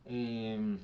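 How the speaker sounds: ordinary talk, nothing marked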